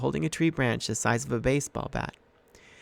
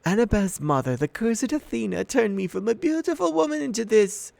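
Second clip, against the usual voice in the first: pitiful grandmother; 11 semitones higher, 4 dB louder than usual. A narrator's reading. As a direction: win his pity. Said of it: "sympathize with a poor old grandmother".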